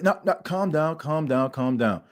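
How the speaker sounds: soft, settling "calm down"; calming coach. Pretend. Coach settling the excited player, soft and fading.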